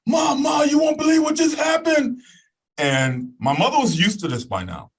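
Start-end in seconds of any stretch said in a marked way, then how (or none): 0.1-2.2 much higher and louder, bursting with news; excited shout. Retold or imitated. Teen rushing home calling his mom, excited shout. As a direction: call out to mom, can't wait to tell. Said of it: "I said".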